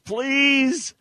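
high, loud, stretched-out plea; all-caps shout. Retold or imitated. Host reading a listener's gushing caps post, shouted. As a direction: yell it out in capital letters. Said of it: "Caps, exclamation point".

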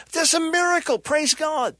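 high, acted cry of wonder; jubilant praise. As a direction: rejoice as an amazed witness. Acted rejoicing at a miracle.

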